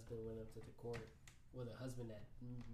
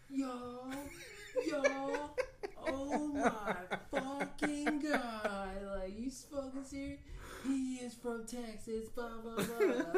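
very high, loud, slow mimicry; mock astonished friends; 9 dB louder, much slower than usual. Imitating her friends reacting to his Texas accent, high mock amazement. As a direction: draw it out in a high put-on squeal, half laughing. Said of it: "what do they sound like".

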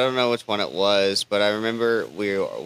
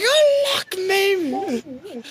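very high, drawn-out imitation of a prisoner; mock desperate plea; much slower than usual. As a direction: wail it slowly, hammed-up, begging for release. Imitates locked-up character pleading.

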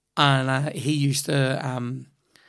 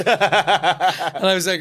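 laughing; 4 semitones higher, 8 dB louder, much slower than usual. Real, not acted. Laughing at the story.

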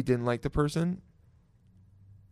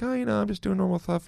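higher, softer, quick mock guest voice; dull guest; 7 semitones higher, 5 dB quieter, faster than usual. Guest answering the host's small talk, mock meek reply. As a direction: play an awkward guest, light and hurried.

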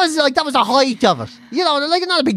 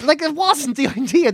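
usual speaking voice

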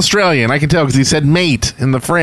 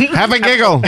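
laughing mock Australian; 6 semitones higher than usual. Mocking Australian phrase.

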